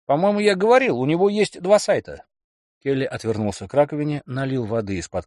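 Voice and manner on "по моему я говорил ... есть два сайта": higher, excited bragging man; boastful boyfriend. Acted. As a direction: sell the big dream loudly. Kelly voiced.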